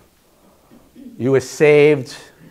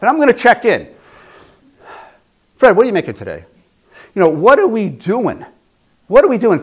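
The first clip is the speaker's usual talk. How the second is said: higher, louder, pressing the warning home; urgent preaching; 5 semitones higher, 4 dB louder than usual. Real, not acted. Preacher warning the congregation time is running out, raised and urgent. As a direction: push the urgency hard, voice raised.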